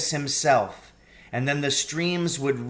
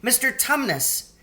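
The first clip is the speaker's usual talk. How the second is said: great distress; 12 semitones higher than usual. A narrator's reading. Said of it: "in great distress".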